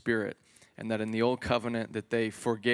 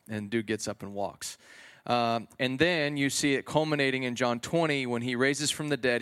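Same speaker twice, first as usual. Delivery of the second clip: ordinary talk, nothing marked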